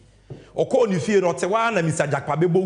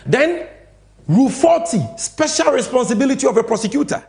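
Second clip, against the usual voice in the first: emphatic announcement; 7 semitones higher, 6 dB louder than usual. Real, not acted.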